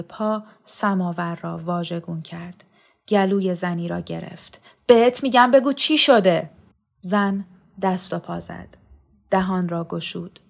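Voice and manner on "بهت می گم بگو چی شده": high, loud order to talk; furious demand. A narrator's reading. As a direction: force a confession out of her. Brother demands answer, grabbing woman's throat. (دستور بلند و خشمگین)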